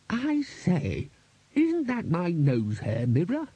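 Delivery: high, slow character voice, questioning; the Bursar's query. A narrator's reading. Bursar spots his mirror among the decorations, high questioning voice. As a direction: ask it high, drawing the words out. Bursar breaks the spell, asking.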